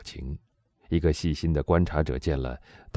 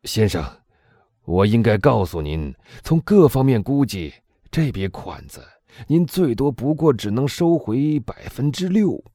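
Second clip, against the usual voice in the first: higher, louder warning to a client; warden cautioning; 8 semitones higher, 4 dB louder than usual. A narrator's reading. Warden Boville warning the Englishman, raised pitch. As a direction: prison warden gravely warns the buyer. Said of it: "说道".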